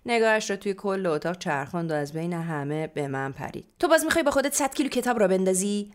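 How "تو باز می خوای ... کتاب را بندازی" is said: fast, loud, high-pitched motherly rebuke; scolding. A narrator's reading. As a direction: pounce on her with an exasperated question. Mother pounces on narrator, scolding. (تشر تند و بلند مادر)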